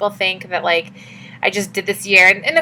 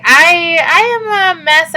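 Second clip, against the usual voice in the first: higher, voice cracking with tears; tearful confession; 8 semitones higher, 5 dB louder, much slower than usual. Real, not acted. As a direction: let the tears break through on the admission. Admits she's a mess, tearful.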